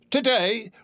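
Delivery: put-on high, puzzled boy; the boy baffled by the question; the boy in Sunday clothes answering, puzzled; surprised boy. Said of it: "replied the boy".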